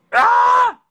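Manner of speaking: high, loud "Ah" scream; short full-force shriek; sudden scream. Real, not acted. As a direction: let out one piercing yell. Sudden scream during the stream.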